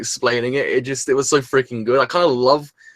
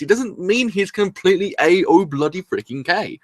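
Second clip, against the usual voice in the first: ordinary delivery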